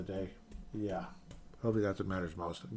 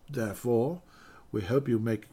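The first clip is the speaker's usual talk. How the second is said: normal talking voice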